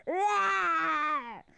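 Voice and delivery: dinosaur roar. Acted. Dinosaur roars in story.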